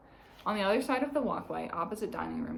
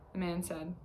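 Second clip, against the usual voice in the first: ordinary delivery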